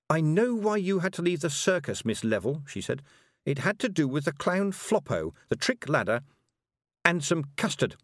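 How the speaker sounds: a hint of a cold, knowing girl; lightly voiced witch girl, sure of herself; knowing young witch. A narrator's reading. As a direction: lay it out like you see right through her. Tiffany accusing Miss Level.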